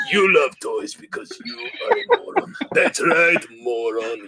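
lightly voiced sneer, laughing through it; mock insult; Boris voice. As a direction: Boris mocks his cousin, lightly voiced. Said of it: "his cousin, Boris".

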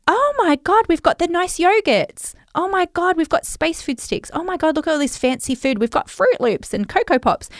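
high, excited squeals over the treats; kids' excitement. Retold or imitated. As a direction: gush like a kid spotting fancy food. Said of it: "And then it would be like".

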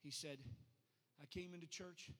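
soft, low storytelling; subdued, low-voiced pulpit anecdote; hushed testimony. Real, not acted. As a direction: tell it quietly after a big climax.

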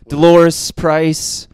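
drawn-out put-on Irish accent. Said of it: "I think that's his Irish accent".

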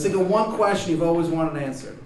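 high, loud, bad Baltimore accent; shitty Baltimore accent. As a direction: introduce yourself in a hammed-up Baltimore accent. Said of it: "shitty Baltimore accent".

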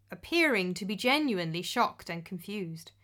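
plain reading voice

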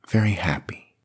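breathy whisper of a blessing; old priest whispers his blessing to the married woman. A narrator's reading.

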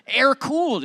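high, loud whine on the word; mock outrage at the old car; exasperated yelp. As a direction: squeal the complaint. Peak of retold complaint.

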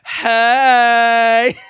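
loud bright presenter shout; chirpy radio presenter. Pretend. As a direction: boom it like a breakfast DJ. Mock chirpy presenter greeting.

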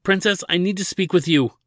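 urgent shout up to the princess; urgent call. Acted. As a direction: call out before anyone else can. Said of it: "Ladin called with a sense of competitive urgency".

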